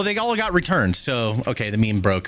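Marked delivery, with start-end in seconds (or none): none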